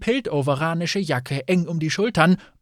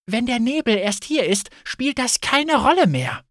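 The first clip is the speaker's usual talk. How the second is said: high, irritated retort; exasperated, raised-pitch objection; snapping; 7 semitones higher, 3 dB louder than usual. A narrator's reading. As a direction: snap back impatiently. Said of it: "fauchte Fayette".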